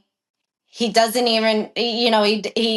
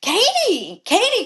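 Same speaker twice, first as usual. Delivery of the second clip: high, falling calls of a name; imitating startled trainer; 9 semitones higher than usual. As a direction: call out in shock, imitating the trainer. Trainer calling out to stop her.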